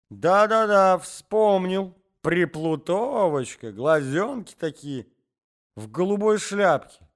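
put-on high, slow forgetful old man; forgetful old husband explaining to wife, high and slow; old man's voice. A narrator's reading. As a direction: ramble like a doddering grandfather.

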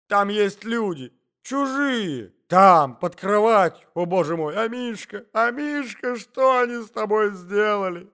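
high, loud melodramatic panic; hysterical cries. A narrator's reading. Lady reports strangers under the bed, hysterical cries. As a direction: wail loudly in alarm and grief.

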